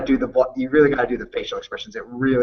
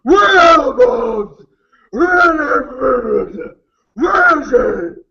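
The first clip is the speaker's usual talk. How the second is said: slow, strained shout much higher and louder; 12 semitones higher, 6 dB louder, much slower than usual. Retold or imitated. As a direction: roar it in a hammed-up gravel-throat rage.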